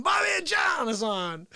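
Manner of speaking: normal talking voice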